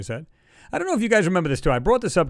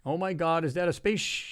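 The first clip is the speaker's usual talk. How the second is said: softer, understated mock amazement; imitated shock; 3 semitones higher, 7 dB quieter than usual. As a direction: lightly mimic her startled question, slightly raised. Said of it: "she's actually shocked".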